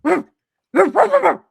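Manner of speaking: loud, high dog barks; playful woof woof at the dog; barking like a dog. Acted. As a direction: bark it out like a friendly dog. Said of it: "he leans down to the dog and he goes".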